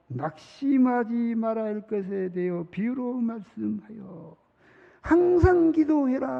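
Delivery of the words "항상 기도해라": much higher and louder, slow, like Jesus commanding; solemn command. Retold or imitated. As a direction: proclaim it as a firm charge. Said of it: "말해요".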